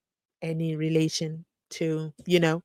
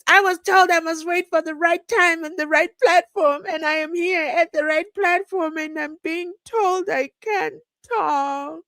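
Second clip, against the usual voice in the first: raised mock-aggrieved complaint; Shadrack Sevilla impression; 11 semitones higher, 4 dB louder than usual. Streamer quoting witness, mock aggrieved higher voice. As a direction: mimic his wounded protest, higher. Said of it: "As Shadrack Sevilla says".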